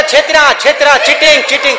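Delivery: cheating! shouted high and loud; outraged shout of cheating. Acted. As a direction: yell betrayed, voice cracking up high. Sinner cries cheating.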